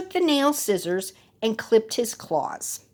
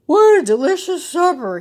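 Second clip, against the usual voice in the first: loud high contented wolf; sated and sleepy; wolf yawning; 8 semitones higher, 8 dB louder than usual. A narrator's reading. Full wolf after eating, yawning and content. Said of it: "he said with a big yawn".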